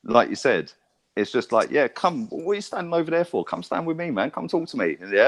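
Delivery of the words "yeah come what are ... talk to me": higher-pitched, friendly call-over; casual beckoning. Retold or imitated. Telling a shy fan to come over, casual high-pitched beckoning. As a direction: wave someone over in a higher, easy voice. Acting out himself inviting onlookers over.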